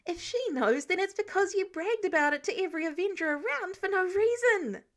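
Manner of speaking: high, loud indignant hiss. A narrator's reading. Peter hissing at Tony over bragging, high and loud. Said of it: "Peter hissed like a cat being sprayed with water".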